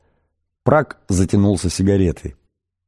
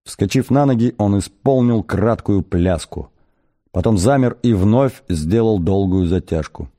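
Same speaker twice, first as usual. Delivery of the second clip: usual speaking voice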